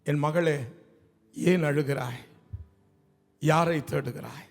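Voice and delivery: low, gentle fatherly questioning; tender, caring. Acted. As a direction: speak low and unhurried, as if comforting a daughter. Jesus tenderly asks weeping daughter.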